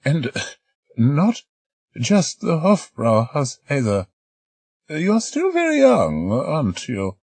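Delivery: put-on high, quavering old woman's voice; elderly lady. Old lady volunteering to stay behind, high old voice. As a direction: play an eager elderly lady.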